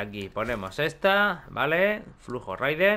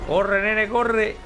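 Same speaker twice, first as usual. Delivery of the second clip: urgent urging; 8 semitones higher, 5 dB louder, much slower than usual. Real, not acted. Gamer urging his character to flee, urgent. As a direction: shout it higher and louder.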